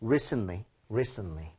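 "Recently" as RE-sn-lee, no audible T; T nearly dropped. Shown as a demonstration. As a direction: let the T in "Recently" vanish. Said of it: "T 사운드가 거의 안 난다".

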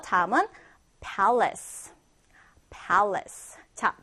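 ordinary talk

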